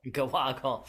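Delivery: "Gawaggle" said guh-WOG-gle; corrected pronunciation. Shown as a demonstration. Host repeating the co-host's version, "Gawaggle" as guh-WOG-gle. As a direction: echo "Gawaggle" with an unstressed first syllable.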